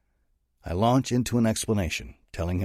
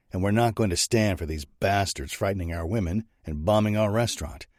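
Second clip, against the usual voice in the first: ordinary delivery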